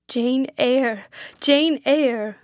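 wondering, emphatic outburst; overcome exclamation. A narrator's reading. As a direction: call her name in amazement. Rochester overcome at finding Jane.

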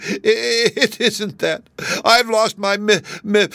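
high-pitched stammering sobs; sobbing. A narrator's reading. Kangaroo tries to name what she lost, sobbing. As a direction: stutter tearfully and collapse into a loud bawl. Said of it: "sobbed the kangaroo".

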